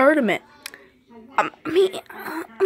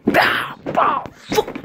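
ordinary talk

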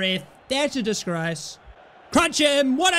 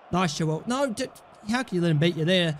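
ordinary delivery

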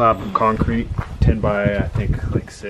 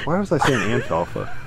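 usual speaking voice

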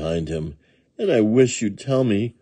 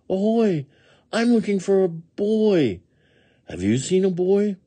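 high-pitched eager puppy call; puppy crying out; 13 semitones higher than usual. A narrator's reading. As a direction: call out your question like a pup. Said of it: "he cried".

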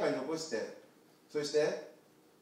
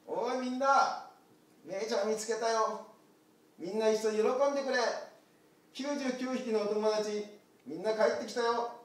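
higher, louder happy announcement; joyful call; 7 semitones higher, 5 dB louder than usual. Acted. Shepherd calling joyfully to the flock. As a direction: call the whole flock to celebrate.

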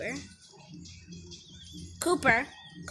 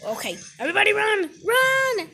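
loud, high, fast alarm call to run; panicked shout; 6 semitones higher, 10 dB louder than usual. Pretend. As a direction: yell at everyone to flee. Animals fleeing in game.

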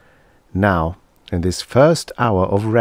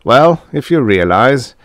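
cheerfully; 5 semitones higher, 6 dB louder than usual. A narrator's reading. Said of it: "he said cheerfully".

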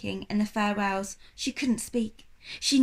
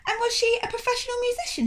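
brisk polite question; 13 semitones higher, faster than usual. Acted. Charles intervenes politely.

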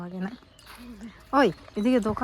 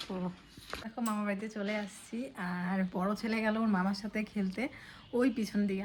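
usual speaking voice

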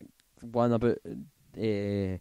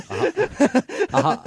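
talking through laughter; high, loud start broken by laughing; real laughter; 8 dB louder, slower than usual. Real, not acted. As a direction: laugh while trying to get it out. Cracking up while making the point.

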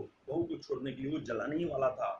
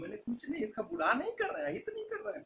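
frightened in-laws; 10 semitones higher, faster than usual. Acted. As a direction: protest innocence in a small, climbing voice. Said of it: "police को देखते ही डर जाते हैं". (डरी हुई, ऊँची दबी आवाज़ में सफ़ाई)

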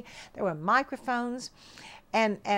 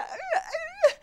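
tearful stammer. Retold or imitated. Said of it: "And I said".

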